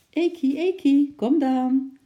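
high, loud coaxing call; calling out to a pet. Acted. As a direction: call the little animal over to you. Lotje calls her squirrel over.

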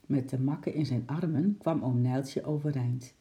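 plain reading voice

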